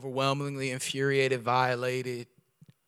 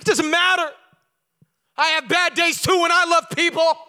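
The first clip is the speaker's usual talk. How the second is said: high, loud defensive retort; loud high indignant reply; heated protest; 12 dB louder than usual. Retold or imitated. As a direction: push back hard at God. Arguing back with God.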